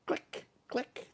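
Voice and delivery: camera click. Shown as a demonstration. Imitating a camera shutter.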